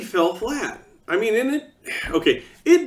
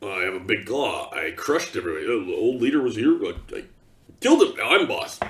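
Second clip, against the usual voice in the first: orc boss; 8 semitones lower than usual. Retold or imitated. Orc boss explaining his rise, deep grunting brag. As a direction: boast like a dumb brute who won by smashing. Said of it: "How are you the orc boss?".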